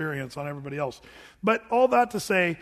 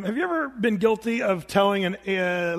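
ordinary talk

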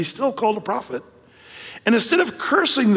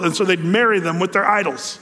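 normal talking voice

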